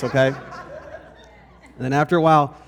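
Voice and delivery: ordinary talk, nothing marked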